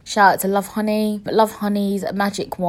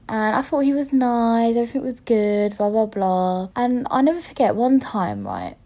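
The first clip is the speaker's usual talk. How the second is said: usual speaking voice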